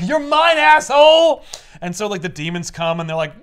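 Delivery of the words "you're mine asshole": gloating villain shout, high and loud; loud, triumphant devilish claim; Mephisto. Acted. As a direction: pounce on your victim with a hammed-up yell. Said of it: "Mephisto shows up and he's like".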